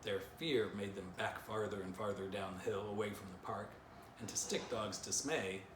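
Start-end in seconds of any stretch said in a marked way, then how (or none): none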